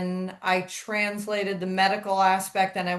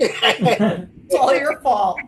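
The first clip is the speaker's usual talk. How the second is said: laughing tease; 2 semitones higher, 7 dB louder, much slower than usual. Real, not acted. Teasing friend after burn story.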